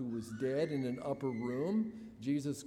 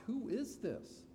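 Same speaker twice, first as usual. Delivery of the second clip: shocked question; 9 semitones higher than usual. Retold or imitated. Stunned bearers asking who this man is, mildly shocked. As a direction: voice the bystanders' mild shock. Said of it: "My guess is they're shocked".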